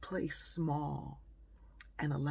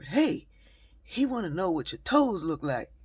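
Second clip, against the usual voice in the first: much louder call across the room; yelled over; 6 semitones higher, 10 dB louder than usual. Retold or imitated. A guy yells over to the woman at the window, loud call. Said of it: "one of the guys yelled over".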